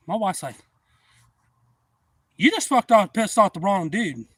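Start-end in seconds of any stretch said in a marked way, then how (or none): 2.4-4.3 loud, high-pitched imitation of an indignant wife; retold angry warning. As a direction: act out the wife's outrage, louder and higher. Wife in retold quarrel with stranger.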